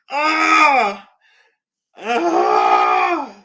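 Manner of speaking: loud hammed-up shriek; mock scared scream. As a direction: shriek in playful panic. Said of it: "I'm so scared".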